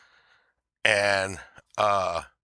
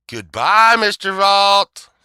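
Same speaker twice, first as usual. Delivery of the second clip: loud, much higher, the name drawn out slowly; mock farewell; 10 semitones higher, 9 dB louder, much slower than usual. Judge baiting him on his way out, loud drawn-out goodbye. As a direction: sing out the goodbye loudly, slowly. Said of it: "he's like".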